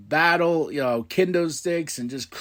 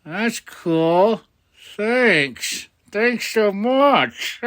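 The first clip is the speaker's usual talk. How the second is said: slow, raised-pitch sarcastic thanks; mock gratitude; 6 semitones higher, much slower than usual. [name] reacting to being volunteered by Dom, sarcastic mock thanks. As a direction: draw out the fake thanks, higher and slower. [name] acted as insincerely grateful.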